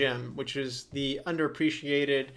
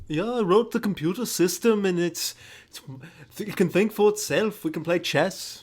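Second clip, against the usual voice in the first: put-on higher, louder Liverpool-ish British accent; 5 semitones higher, 4 dB louder than usual. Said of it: "a [name] specific British accent".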